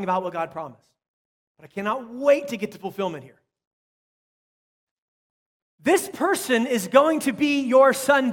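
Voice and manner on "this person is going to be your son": emphatic divine promise. Acted. Preacher voicing God's promise to David.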